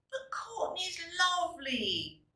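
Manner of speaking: lightly voiced children, hurt; children pleading. Children defend Courtney to their cross parents, pleading. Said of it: "said the children".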